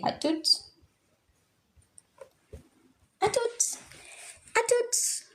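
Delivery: "Atut" said softly, rising high and cute; cute short form. Shown as a demonstration. Teacher cooing the cute short form for students. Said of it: "so basically just say".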